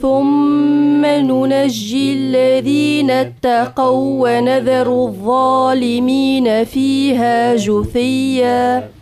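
usual speaking voice

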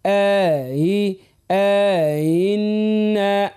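very slow chanted آئنا, each sound held; long first hamza. Shown as a demonstration. Teacher leading listeners to repeat, very slow chanted آئنا. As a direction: hold آي long, then glide through آئنا. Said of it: "عودوها كما نقول".

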